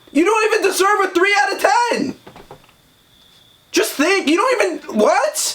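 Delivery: high-pitched, exasperated outburst; incredulous outrage. Acted. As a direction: voice leaping up in disbelief. Mock outrage at the complainer.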